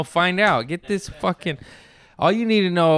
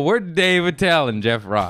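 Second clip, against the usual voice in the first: ordinary delivery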